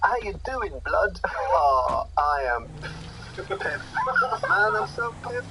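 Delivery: lightly acted slang chat, lifted pitch; casual mock street banter; slang-talking youth. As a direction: play a young person greeting a mate. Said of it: "Someone says".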